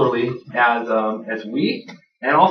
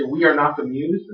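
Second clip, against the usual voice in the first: ordinary delivery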